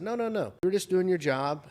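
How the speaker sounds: high-pitched, hurried-off apology, lightly acted; retold reassurance. Man telling apologetic guard it's fine, lightly acted reassurance.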